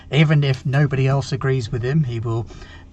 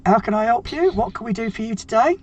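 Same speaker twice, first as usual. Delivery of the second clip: high, chattering welcome; eager greeter; 7 semitones higher, 3 dB louder than usual. Acted. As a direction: rattle off greetings in a raised voice. Voiced figure greets Bazo's visitors.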